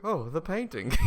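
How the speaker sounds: quoted realization. Acted. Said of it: "we'll be like".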